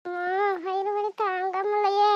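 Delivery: soft, aching moan of stomach pain.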